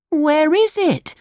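put-on high, slowed puppet-boy voice; high storybook Pinocchio; puzzled Pinocchio. A narrator's reading. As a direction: ask it high, searching for something missing. Pinocchio cannot find the tree.

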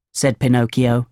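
plain reading voice

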